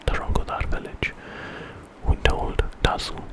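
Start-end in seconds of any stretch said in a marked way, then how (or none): none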